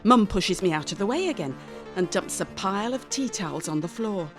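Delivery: usual speaking voice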